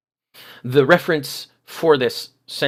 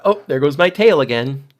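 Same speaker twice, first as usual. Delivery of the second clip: snide remark; 4 semitones higher, 7 dB louder than usual. Retold or imitated. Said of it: "snide remark".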